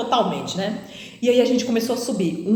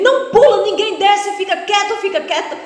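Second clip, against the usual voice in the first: high, loud, fading commands; urgent shout; 10 semitones higher, 5 dB louder than usual. Retold or imitated. Driver ordering the passengers to stay still, loud. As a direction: shout the order, then ease off.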